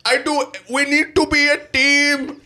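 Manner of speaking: high, slow hammed-up Indian accent. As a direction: ham up a bad Indian accent. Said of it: "terrible nineties Russell Peters impression".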